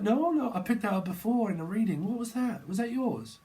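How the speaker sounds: very high squeaky questions; squeaky animal voice. Pretend. The animal asking whether it was yours, squeaky and high. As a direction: talk like a fretful little critter.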